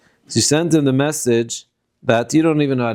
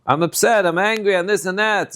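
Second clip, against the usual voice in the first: mock scream-it-out; 7 semitones higher than usual. Shown as a demonstration. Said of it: "screaming it out".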